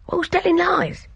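put-on high, cheeky boy's retort; boy's indignant retort. A narrator's reading. Ernie snapping back at Gina, high and cheeky. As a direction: toss it back as a defiant boy.

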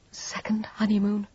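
stunned breathless whisper. A narrator's reading. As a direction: barely breathe the words. Echoes his words in shock.

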